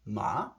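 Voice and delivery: short "ma" rising quickly; brief "ma" with a climbing tone; Chinese rising tone. Shown as a demonstration. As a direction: flick "ma" upward in pitch. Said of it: "the Chinese language is very much tonal".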